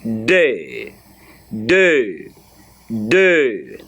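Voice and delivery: "Deux" with a rounded "ø" sound and no final x; model word deux. Shown as a demonstration. Teacher repeats deux for students to copy. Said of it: "Let me say deux".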